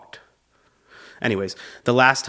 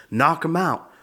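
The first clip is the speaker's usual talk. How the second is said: higher-pitched, urgent cornerman; mock-intense corner coach; boxing coach; 6 semitones higher than usual. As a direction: imitate a coach urging on a fighter in the last round. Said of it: "my coach is in the corner".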